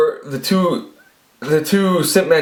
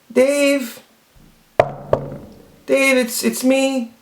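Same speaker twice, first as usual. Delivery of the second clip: put-on high voice of a woman calling; high-pitched pleading woman; 12 semitones higher, much slower than usual. Woman calling to the man hiding inside, high mock voice. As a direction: call out high, imitating her through the door. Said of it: "all of a sudden I hear".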